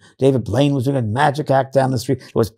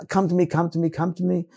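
mock pleading; 7 semitones higher than usual. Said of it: "A lot of it is".

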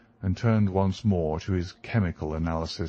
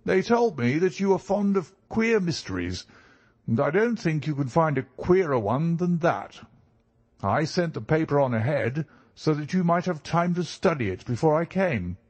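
high-pitched, eager client voice; visiting country gentleman; 7 semitones higher than usual. Acted. Client brings Holmes a queer mystery, eager and high-pitched. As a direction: voice the keen visitor well above narration pitch.

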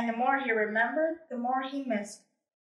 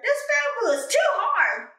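whiny complaint; 10 semitones higher, 4 dB louder than usual. Acted. Complaining about bamboo.

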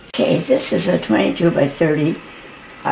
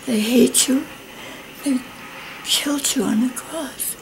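whispering; 6 semitones higher, 5 dB quieter than usual. Retold or imitated. Whispered retelling of vision.

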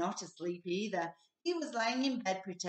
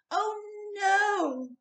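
high, loud weary groan of a fed-up father; a tired dad at the end of his rope; exasperated Mister Bear; 12 semitones higher, 5 dB louder than usual. A narrator's reading. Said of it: "said Mister Bear".

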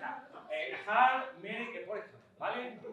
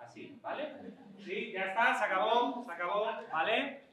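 usual speaking voice

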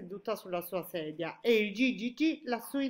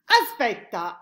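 loud, raised interjection; exclamation; 4 semitones higher, 12 dB louder, slower than usual. A narrator's reading. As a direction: call out to stop them. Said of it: "esclamò il G G G". (esclamazione ad alta voce)

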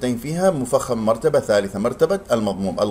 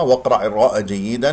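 ordinary talk, nothing marked

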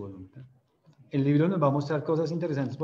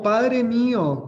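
slow, loud, high wail of loss; drawn-out cry; 10 semitones higher, 8 dB louder, much slower than usual. A narrator's reading. Elisha calling after his master carried up to heaven, slow loud wail. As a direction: stretch the cry out long and loud.

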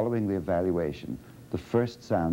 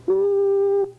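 a much higher, louder ooh; 7 dB louder than usual. Shown as a demonstration. Therapist showing Dina high pitch on ooh. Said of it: "What about pitch?".

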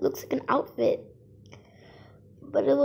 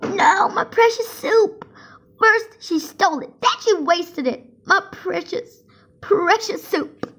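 wailing; 8 semitones higher, 7 dB louder than usual. Acted. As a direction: wail as if grieving. Mourning the wasted soup.

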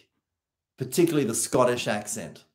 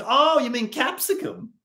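dawning self quote; 11 semitones higher than usual. Retold or imitated. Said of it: "I'm like".

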